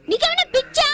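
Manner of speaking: high, loud, incredulous snap; angry retort. Acted. Daughter snapping at her father in angry disbelief. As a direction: throw it at him in disbelief.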